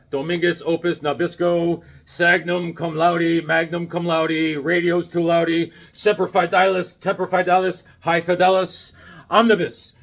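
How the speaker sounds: slow, even mock-Latin liturgical chant; mock priest reading. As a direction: intone nonsense Latin like a priest. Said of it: "the guy comes in and he's reading".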